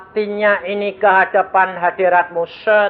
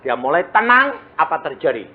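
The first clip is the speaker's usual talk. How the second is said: ordinary talk, nothing marked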